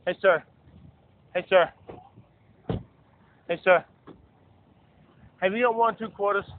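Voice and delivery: loud calls of hey sir to strangers; calling out for change. Real, not acted. Panhandler calling to passing men for change, loud. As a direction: call out to catch a passer-by. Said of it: "I'm panhandling".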